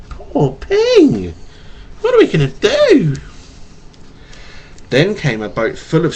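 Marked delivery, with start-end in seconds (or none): 0.1-3.2 high, loud exaggerated pity; mock pity. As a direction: ham up the worry for the child. Pity for lost duck.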